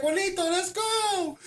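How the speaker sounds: high, drawn-out plea; urgent calling out. Retold or imitated. Scared kid calling his friend to run, high and urgent. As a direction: call your friend away in a high, slow cry.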